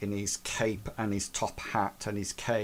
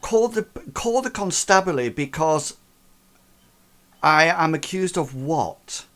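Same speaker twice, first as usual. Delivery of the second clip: arrogant, higher, fast, indignant scoff; 5 semitones higher, 4 dB louder than usual. Acted. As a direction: scoff it high and fast like an affronted showman.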